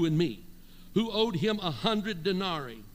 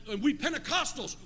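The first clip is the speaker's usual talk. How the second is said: usual speaking voice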